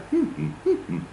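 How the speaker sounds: high hummed beats with peaks and dips; stress-timed rhythm. Shown as a demonstration. Teacher shows how stress-timed languages sound, hummed peaks and troughs. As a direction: hum uneven beats, jumping high then dropping. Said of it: "stress time ones sound".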